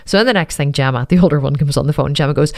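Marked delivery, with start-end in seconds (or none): none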